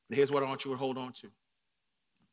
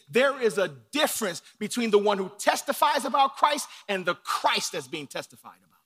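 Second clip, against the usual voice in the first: rising, louder preacher's build; preaching at full force; 5 semitones higher, 7 dB louder than usual. Acted. Preacher driving his point home to the congregation, rising force.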